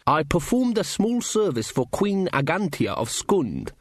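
a measured, slow dwarf count, lightly voiced; Casanunda's character voice. A narrator's reading.

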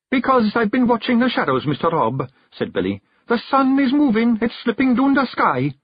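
a lad confessing his fear for her; young Billy, earnest and scared. A narrator's reading. Billy tells Rob his fear for the hag, frightened high voice. Billy warns about the sun.

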